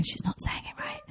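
breathy, unvoiced whisper of nitpicking listeners; mocking whisper. Imitating critics of her pronunciation, whispering. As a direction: hiss it like a smug pedant correcting her. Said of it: "loads of people out there going".

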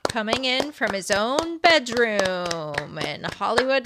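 usual speaking voice

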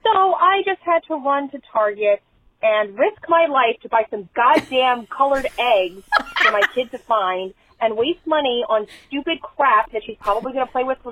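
ordinary talk

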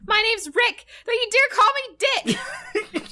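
high, loud hammed-up shout; mock indignant outburst; Rick. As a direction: yell it like an outraged melodrama hero. Acting out Rick's angry outburst.

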